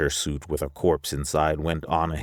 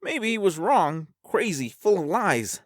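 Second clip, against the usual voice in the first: much higher character voice, argumentative; high-voiced Alvar. Alvar doubting the dead old man, high character voice. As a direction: voice a spaceman in a high pitch.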